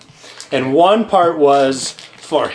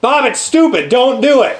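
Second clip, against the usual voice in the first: higher, retold exasperated plea; exasperated pleading; 6 semitones higher than usual. Retelling an argument with a show partner, exasperated. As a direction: plead with a stubborn friend, pitched up.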